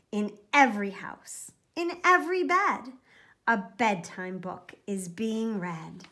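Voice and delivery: ordinary delivery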